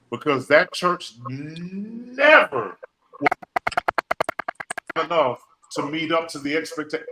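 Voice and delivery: preacher's dramatic halting delivery. Real, not acted. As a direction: drag it out for weight. Slow dramatic sermon pause.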